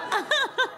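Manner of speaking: a sudden high burst of laughter; real laughter at her own story. Real, not acted. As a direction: laugh out in disbelief. Laughing at the weight.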